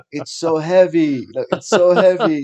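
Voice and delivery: loud, drawn-out retold exclamation; exclaiming taxi driver. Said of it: "He's like".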